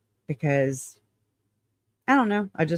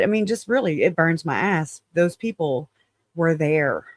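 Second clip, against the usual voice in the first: normal talking voice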